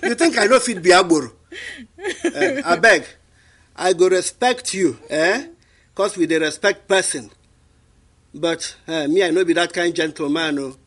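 Nigerian Pidgin street voice. Pretend. Answers he's too proper to act.

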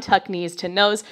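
ordinary talk

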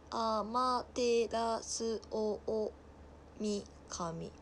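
very slow, syllable by syllable; sounding out a name. Real, not acted. Streamer working out an unfamiliar god's name, sounding it out. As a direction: read it piece by piece, haltingly.

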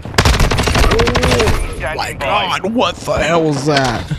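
stretched-out disbelieving exclamation; raging gamer. Real, not acted. Stunned by sudden enemy kill.

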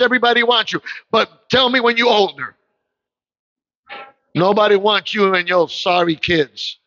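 ordinary delivery

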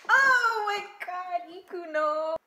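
high, loud, drawn-out squeal; excited squeal. Real, not acted. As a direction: gush over a favorite character.

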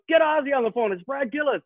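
high, eager shouting into the mic; excited shout. Retold or imitated. Brad grabs the CB to hail Ozzy, shouting high. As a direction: yell it like a thrilled kid.

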